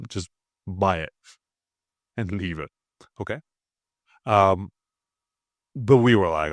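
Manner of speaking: ordinary talk, nothing marked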